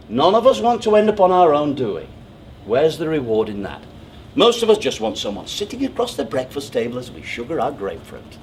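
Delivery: usual speaking voice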